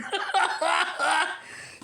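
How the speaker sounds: high rising laugh. Real, not acted. Laughing at Rush jab.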